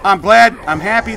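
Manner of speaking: loud, high burst of glee; excited outburst. Real, not acted. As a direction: blurt it with a jolt of joy.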